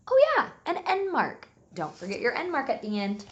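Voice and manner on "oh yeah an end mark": high, loud "oh yeah" as if just remembering; mock realization. Teacher showing her class she nearly forgot, mock surprised. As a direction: pretend to suddenly remember for the kids.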